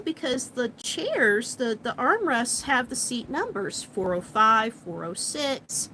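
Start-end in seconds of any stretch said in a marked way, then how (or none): none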